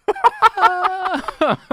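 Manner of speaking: loud, very high-pitched laugh; helpless high laughter, half crying; laughing near tears. Real, not acted. Dad reliving the painful reminder, laughing near tears. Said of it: "I'm laughing".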